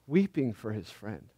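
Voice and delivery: a quiet echo that lingers on "Weeping"; hushed repeat. Shown as a demonstration. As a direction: let the words sink into a soft, reverent hush.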